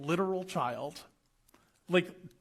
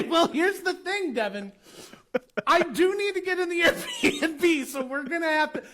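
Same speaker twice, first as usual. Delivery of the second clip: high, loud, slowed-down explanation, half laughing; mock strained patience; 11 semitones higher, 7 dB louder than usual. Guest tells child he must get inside, mock strained patience.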